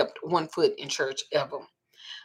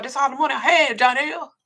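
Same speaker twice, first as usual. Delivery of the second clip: high, laughing plea; whiny plea; 8 semitones higher, 5 dB louder than usual. Retold or imitated. Pastor pleads with the dad, high and laughing. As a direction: plead higher through a laugh. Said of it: "He told me".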